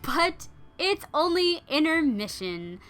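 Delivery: high, loud theatrical proclamation; hammed-up cliffhanger narration; dramatic announcer. A narrator's reading. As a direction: draw it out like a grand intermission announcement.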